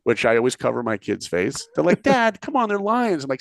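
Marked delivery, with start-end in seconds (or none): 2.0-3.2 put-on high protesting child's voice, much higher; whiny kid. Kid objecting to dad covering his eyes, high whiny protest. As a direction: whine it like an embarrassed kid.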